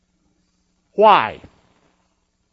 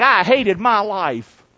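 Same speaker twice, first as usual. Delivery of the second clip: slow scripture reading; slower than usual. Acted. Reads quote slowly.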